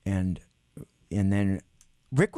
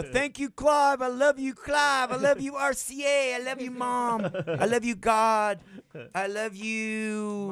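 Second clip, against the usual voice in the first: high, slow, gushing thank-yous; mock acceptance speech; 13 semitones higher, slower than usual. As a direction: ham up an overwrought winner's speech.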